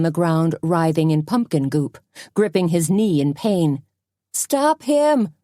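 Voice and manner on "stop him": high urgent shout. A narrator's reading. Suzanne shouting at the crowd, high urgent shout. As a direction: shout it to stop the killer. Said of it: "Suzanne shouted again".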